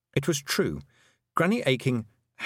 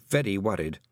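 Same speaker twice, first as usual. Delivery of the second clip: plain reading voice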